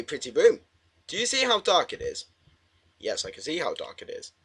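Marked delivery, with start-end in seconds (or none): 1.1-2.2 lightly voiced game character, pitched high; high, lightly put-on questioning voice; character's question. 3.0-4.3 soft, low-pitched answer; low quiet reply. Real, not acted. Player answers the character drily.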